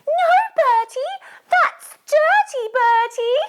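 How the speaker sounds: very high, loud mum telling-off; scolding mum. Acted. Mum catching Bertie picking up a lolly, scolding. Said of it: "If his mum saw him".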